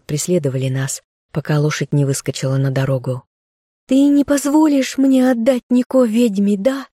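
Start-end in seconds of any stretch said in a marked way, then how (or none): 3.9-6.9 shouting through wind. Acted. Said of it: "кричала я сквозь ветер".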